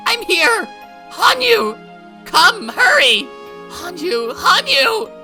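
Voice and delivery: loud, very high screams, near hysteria; frantic shouting. Acted. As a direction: shout her name like you've gone mad. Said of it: "I kept shouting her name as if I'd gone mad".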